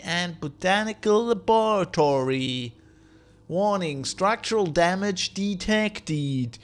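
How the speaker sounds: slow, stretched-out system voice; drawn-out robotic announcement; computer announcer. Acted. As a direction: read it like an automated terminal, syllable by syllable. Reads terminal in announcer voice.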